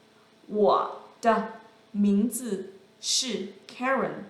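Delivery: "我的名字是Karen" slow, each tone clear; slow model sentence. Shown as a demonstration. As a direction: lay out "我的名字是Karen" slowly for learners. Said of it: "The second sentence".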